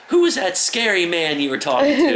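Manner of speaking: the mother asks her son about the scary man: high mock worried mom. High mock-alarmed mother.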